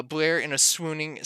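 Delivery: ordinary talk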